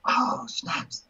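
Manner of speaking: breathy whispered groan of defeat; whispered dismay. Retold or imitated. Kicked out by the captain, muttering to himself in a whisper. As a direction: whisper it under your breath, caught out. Sailor dismissed by captain at mast.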